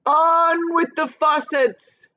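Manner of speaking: high, slow, booming far-off shout; cloud man shout. A narrator's reading. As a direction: bellow the order from the sky. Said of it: "it shouted".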